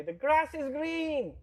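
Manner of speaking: high, slow, drawn-out voice; high-pitched swami. Retold or imitated. Teacher swami lecturing novices in high, slow voice. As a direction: mimic the old teacher's squeaky pronouncement. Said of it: "he had a high-pitched voice".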